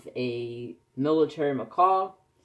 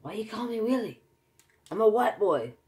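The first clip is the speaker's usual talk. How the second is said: little brother voice; 9 semitones higher than usual. Retold or imitated. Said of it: "He used to say all the time".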